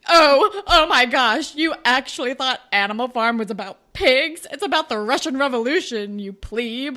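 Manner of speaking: loud, high, smug nerd voice; nerdy know-it-all. Retold or imitated. Claire and Allie correcting the boys, loud smug nerds. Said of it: "such goddamn nerds 'cause they're like".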